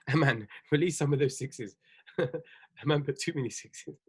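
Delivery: soft, laughing amusement at the chat; laughing through the words. Real, not acted. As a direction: chuckle the line out, barely holding it together.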